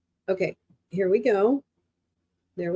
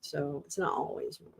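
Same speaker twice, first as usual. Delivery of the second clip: much softer, lower voice; quiet restatement; 5 semitones lower, 14 dB quieter than usual. Real, not acted. Streamer settling down after an outburst, quiet and low. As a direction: say it low and subdued.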